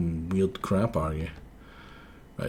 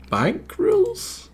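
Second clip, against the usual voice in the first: much higher, slow disbelief; incredulous; much slower than usual. Acted. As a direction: stretch it out in disbelief. Incredulous question.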